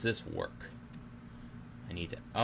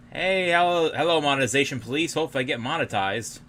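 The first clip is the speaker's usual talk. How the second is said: loud, high playful hello to the monetization police; mock announcer greeting; 7 semitones higher, 8 dB louder than usual. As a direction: hail them like a cheeky show host.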